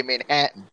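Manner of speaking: "Manhattan" with the middle syllable hat stressed; "Manhattan", hat syllable punched; Kansas Manhattan. Shown as a demonstration. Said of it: "that's how we say it out here in Kansas".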